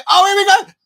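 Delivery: sudden high, loud burst of excitement; excited cry. Real, not acted. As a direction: jump up as the attack starts. Match chance, watching live.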